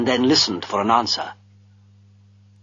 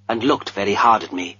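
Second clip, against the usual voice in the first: usual speaking voice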